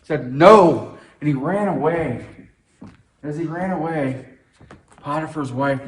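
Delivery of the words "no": loud sharp "No!"; firm refusal. Retold or imitated. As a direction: shout the refusal. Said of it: "Joseph scre-, said".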